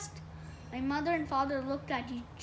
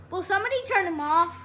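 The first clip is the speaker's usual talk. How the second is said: loud, fast, higher plea; exasperated; 4 semitones higher, 8 dB louder, faster than usual. A narrator's reading. Older brother fed up with the reciting, exasperated. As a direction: burst out at the whole table, fast.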